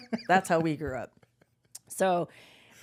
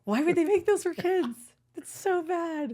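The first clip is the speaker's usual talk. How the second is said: high, louder incredulous outburst; laughing disbelief; 11 semitones higher, 4 dB louder than usual. Real, not acted. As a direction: exclaim it high-pitched through laughter. Repeats disbelief at candy cigarettes.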